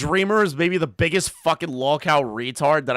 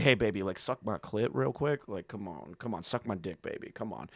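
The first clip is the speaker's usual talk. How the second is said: much lower and softer, creepy pushy voice; sleazy come-on; 9 semitones lower, 12 dB quieter than usual. Retold or imitated. Streamer acts out a creep harassing someone, low sleazy murmur. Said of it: "going up to them and be like".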